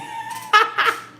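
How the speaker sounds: high breathless cackle; laughing. Real, not acted. Cracking up over not knowing any Miskito, real laughter. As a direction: crack up at yourself. Laughing at own failed singing.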